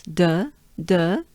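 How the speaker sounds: de with jaws forward, a rounded vowel; French de. Shown as a demonstration. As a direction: model de separately as a French syllable. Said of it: "forward movement of your jaws".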